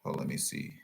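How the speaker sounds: whispered to himself. Real, not acted. Checking the photo, whispering to himself. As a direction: whisper it while looking closer. Peering at the picture.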